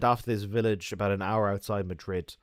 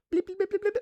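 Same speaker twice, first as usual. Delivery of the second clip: measuring pour noise; faster than usual. Retold or imitated. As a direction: mimic a careful measured pour. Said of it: "they go".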